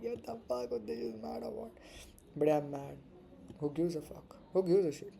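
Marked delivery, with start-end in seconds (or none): 0.0-1.7 higher, softer, incredulous imitation of a viewer; lightly voiced confused onlooker; mock viewer reaction. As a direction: play the baffled viewer, half laughing. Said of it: "You probably like".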